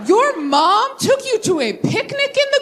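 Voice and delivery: real laughing amazement at the answer; laughing disbelief. Real, not acted. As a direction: stretch it out through laughter, amazed. Delighted disbelief at picnic story.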